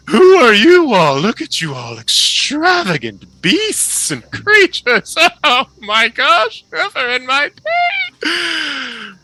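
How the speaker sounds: very high, loud, theatrical showman voice; flamboyant halfling. Acted. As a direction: gush like a delighted stage impresario.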